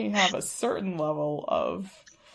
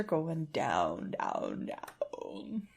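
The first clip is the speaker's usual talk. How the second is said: fading echo; 5 semitones lower, 10 dB quieter, much slower than usual. Pretend. Elevator going down, fading words.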